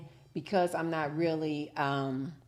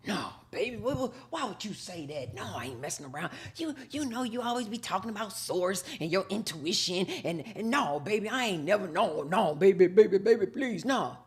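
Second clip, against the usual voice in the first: put-on pleading boyfriend; 2 semitones higher than usual. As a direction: beg her not to believe it. Cheating man pleading.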